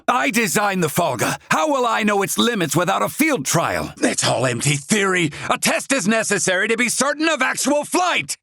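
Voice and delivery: heated insistence. Acted. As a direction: demand the test flight, voice climbing in frustration. Insisting on a field trial.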